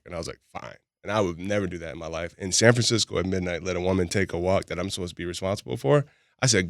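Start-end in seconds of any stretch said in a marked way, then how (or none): 0.5-0.8 low-key retold sulk; quiet, annoying. Man answering partner taking a walk, quietly annoying. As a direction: drop it quietly, needling. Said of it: "I can be real quiet and, like, annoying".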